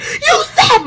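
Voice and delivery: very high, squealed cry; acted-out tearful screech; retold crying scream. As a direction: wail it out high like a screaming teen. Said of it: "So I started screaming".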